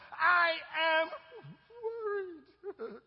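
loud, high, crying whine of worry; mock cry of worry. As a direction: wail it like a self-pitying believer. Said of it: "proclaiming to yourself and to your friend".

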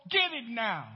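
ordinary delivery

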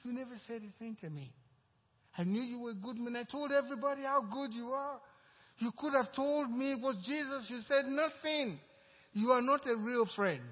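hurt friend yelling at a betrayal; angry outburst. Retold or imitated. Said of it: "He was very angry".

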